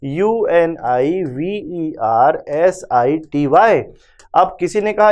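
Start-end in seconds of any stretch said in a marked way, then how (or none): 0.0-3.8 slow letter-by-letter spelling. Shown as a demonstration. Teacher spelling the word for students, slow and letter by letter. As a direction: spell each letter out slowly for the class. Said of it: "जैसे university लिखा होता है".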